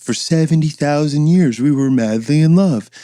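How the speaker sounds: high, loud, sing-song mockery of a sappy couple; mock lovey couple. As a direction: ham up a dreamy couple in love.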